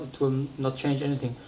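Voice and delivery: ordinary talk, nothing marked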